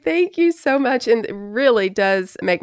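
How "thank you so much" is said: sweet high thanks. Retold or imitated. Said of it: "I'd be like".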